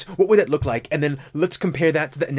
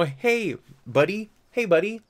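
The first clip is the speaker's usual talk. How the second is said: mock texting voice; 6 semitones higher than usual. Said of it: "that's me texting".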